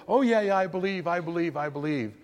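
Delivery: a bored churchgoer brushing it off; half-hearted believer. Retold or imitated. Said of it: "sitting in a pew in a church".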